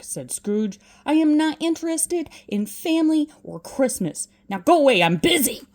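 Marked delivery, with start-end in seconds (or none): 1.1-5.6 loud, impatient brush-off; louder and louder angry old man; angry dismissal. Acted. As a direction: order him out of the room. Scrooge ordering Fred out.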